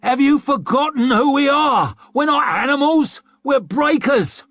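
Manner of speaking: high, loud furious rebuke; a loud, high angry rebuke. A narrator's reading. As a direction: shame the mob. Curbman rebukes the mob.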